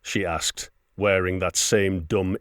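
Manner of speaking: ordinary delivery, nothing marked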